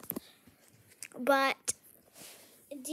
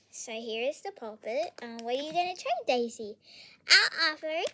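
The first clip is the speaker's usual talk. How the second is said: normal talking voice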